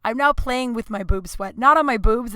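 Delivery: ordinary talk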